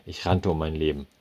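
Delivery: usual speaking voice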